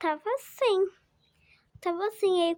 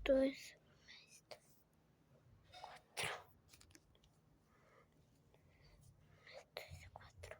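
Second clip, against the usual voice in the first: whispered counting; 4 semitones lower, 21 dB quieter, slower than usual. Real, not acted. Counting under breath.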